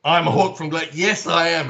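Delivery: a loud, hammed-up yes, I am Hawk; giving in. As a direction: play along and own it. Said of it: "So I've just gone".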